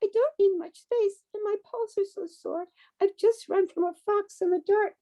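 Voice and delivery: high, tiny pleading mouse voice; little mouse voice. A narrator's reading. Adult reading a bedtime story, voicing the mouse: high and small. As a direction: squeak it out like a small scared mouse.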